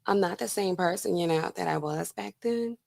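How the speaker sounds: a hint of a humble, soft-spoken plea; Holmes soft voice now. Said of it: "She's probably now like".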